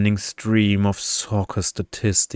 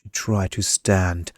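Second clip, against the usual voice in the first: plain reading voice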